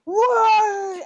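high, drawn-out whoop; imitated involuntary cheer. Impressed fan re-enacting her own outburst, involuntary whoop. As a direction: let it burst out high. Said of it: "it came out".

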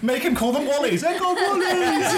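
high, slowed, giggling; laughing mimicry. Real, not acted. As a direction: squeal Wallies through laughter.

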